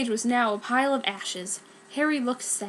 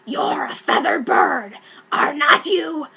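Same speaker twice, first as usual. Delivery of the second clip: slow, louder creature voice; Brom's voice; 4 semitones higher, 5 dB louder, slower than usual. Acted. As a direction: croon to the bird, creature-like. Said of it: "Brom said".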